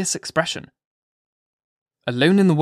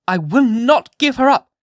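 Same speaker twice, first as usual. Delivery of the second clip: high, loud near-shriek; almost a shriek; 10 semitones higher, 7 dB louder than usual. A narrator's reading. Hester repeating her claim to the magistrates, near shriek. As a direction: raise her voice till it nearly breaks into a shriek. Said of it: "raising her voice almost to a shriek".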